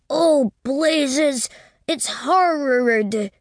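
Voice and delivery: put-on high, boyish voice, horrid drawn out slowly; small boy complaining to Winterbourne, high and slow; child's exclaim. A narrator's reading. Peculiar drawn-out complaint. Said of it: "pronouncing the adjective in a peculiar manner".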